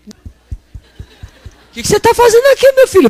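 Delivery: high squeaky surprised host voice; startled host. Acted. Preacher acting the host caught off guard, high and surprised. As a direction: play the flustered host catching an early guest.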